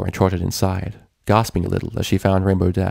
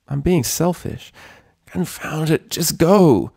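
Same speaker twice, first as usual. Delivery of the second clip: higher, fretful back-and-forth; anxious self-talk; 6 semitones higher than usual. A narrator's reading. Twilight pacing before confessing, nervous. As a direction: argue with yourself nervously.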